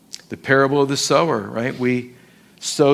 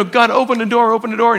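high, loud pleading prayer; mock pleading; 8 semitones higher, 6 dB louder, much faster than usual. Preacher re-enacts praying to God for an open door, mock pleading. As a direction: beg urgently, pitched up and louder. Acts out his own urgent prayer.

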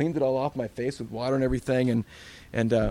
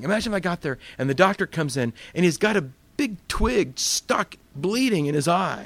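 usual speaking voice

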